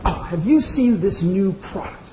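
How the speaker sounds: slow, drawn-out mock reporter voice; imitating the reporter. Mimicking the reporter asking about the product, slowly. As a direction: lean into her indignant question. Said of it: "And she's like".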